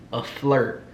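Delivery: usual speaking voice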